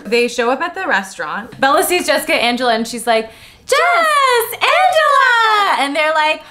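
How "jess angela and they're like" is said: squealing. Pretend. Squealing girl greeting.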